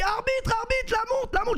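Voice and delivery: very high, squeaky mock complaint; Spanish defender. Spanish defender complaining to referee about watch, high mocking voice. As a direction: whine to the referee in a tiny high voice. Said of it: "le gros défenseur espagnol, il a dit".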